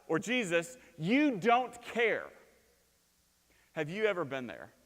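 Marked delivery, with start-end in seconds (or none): 0.1-2.3 slow, pleading accusation from the disciples; a hurt cry at God; accusing cry. Acted. Disciples in the storm accusing Jesus, slow and pleading.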